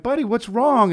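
gentle high worry, like a dad kneeling by his son; high tender concern. Retold or imitated. Dad asks his crying son what's wrong, high and gentle.

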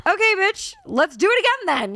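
high-pitched, fed-up retort; exasperated comeback. Retold or imitated. Traveler retells answering TSA agent, exasperated.

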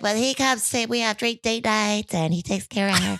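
put-on high, sweet baby voice; little baby voice. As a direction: gush like a baby talking. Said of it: "went up back into her little baby voice".

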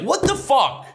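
high, outraged what the fuck; exasperated disbelief. Retold or imitated. Comic seeing one bored audience member, high disbelief. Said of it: "I'm like".

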